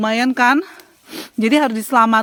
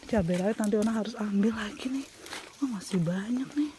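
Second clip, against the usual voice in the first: soft unvoiced whisper; 2 semitones lower, 12 dB quieter than usual. Real, not acted. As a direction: hush it, breathy whisper. Whispering while picking chilies outside.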